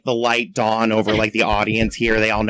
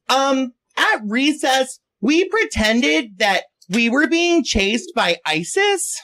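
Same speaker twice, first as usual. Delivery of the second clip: put-on high, slow little-kid voice; drawn-out schoolgirl answer, pitched way up; nine-year-old girl; 12 semitones higher, much slower than usual. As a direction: imitate a child reporting on recess. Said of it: "her nine-year-old ... And the girl goes".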